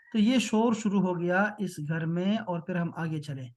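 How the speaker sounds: usual speaking voice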